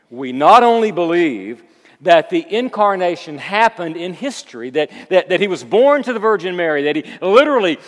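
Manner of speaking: loud, urgent sermon delivery; emphatic preacher in full flow; forceful preaching. Acted. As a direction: build conviction from the pulpit with punched words.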